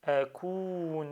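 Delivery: أكون with a long held "uu"; prolonged vowel. Shown as a demonstration. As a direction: stretch the waw in أكون, then a short "na". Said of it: "Donc je prolonge".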